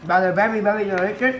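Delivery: talking with mouth full; words round a bite of food. Real, not acted. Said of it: "sorry for talking with my mouth full".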